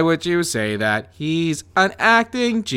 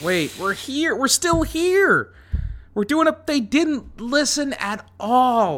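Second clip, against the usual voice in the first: ghost voice; 9 semitones higher than usual. Acted. Ghosts return, eerie voice.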